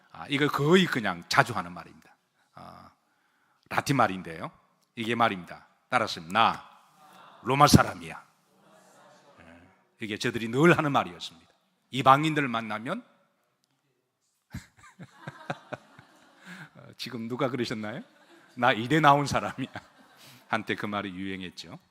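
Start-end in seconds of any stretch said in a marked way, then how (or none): none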